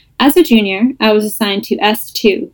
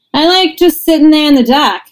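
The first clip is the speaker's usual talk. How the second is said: put-on light Boston accent, quoting the boss; Boston accent, a bit higher; 6 semitones higher, 3 dB louder than usual. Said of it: "In his thick Boston accent".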